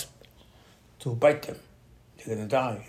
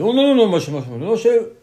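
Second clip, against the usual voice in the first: loud, high burst of mock pleading; imitated panicky objection; urgent pleading; 8 semitones higher, 11 dB louder than usual. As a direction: cut in loudly, begging him to stop. People pleading with Moshe, acted out.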